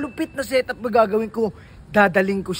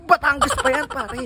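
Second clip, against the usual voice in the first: usual speaking voice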